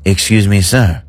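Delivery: snooty French waiter. Retold or imitated. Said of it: "And he goes".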